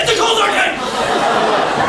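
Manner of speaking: slow, high and loud; insistent shout. Acted. Storyteller overriding hecklers who mock his setting, slow loud insistence. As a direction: hammer the opening line over their objections. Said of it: "It's eighty degrees".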